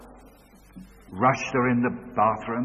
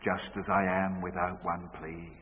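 low, soft repetition; a father mumbling it back; quiet repeat; 5 semitones lower, 7 dB quieter than usual. Retold or imitated. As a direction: echo the line quietly. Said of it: "And he said".

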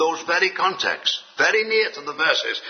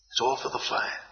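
hushed repeat; 8 dB quieter than usual. Shown as a demonstration. Preacher repeating the old saying to his flock, hushed. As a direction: softly breathe it, almost a whisper.

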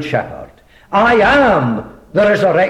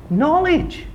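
echoed high; 12 semitones higher than usual. Shown as a demonstration. Preacher echoing the word Moses was given, high and awed. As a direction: float "Knowledge." up high as an awed echo.

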